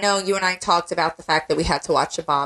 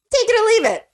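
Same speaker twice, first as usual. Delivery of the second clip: higher, louder mock announcer delivery; playful announcer voice giving the review's verdict; 14 semitones higher, 4 dB louder, faster than usual. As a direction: proclaim it like a showman to the audience. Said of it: "ladies and gentlemen".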